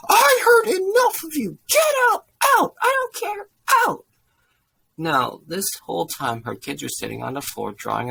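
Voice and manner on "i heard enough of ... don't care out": high, full-force yelling; priest's furious shout. A narrator's reading. Priest throws the woman out, shouting furiously. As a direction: bellow it for the whole hall to hear. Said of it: "he shouts loud enough for the whole hall to hear".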